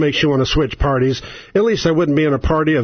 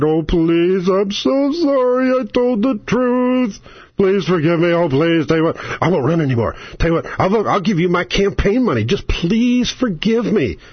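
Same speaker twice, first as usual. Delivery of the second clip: grovel and beg, pitched up; mock begging senator; 3 semitones higher than usual. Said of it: "did exactly what they said".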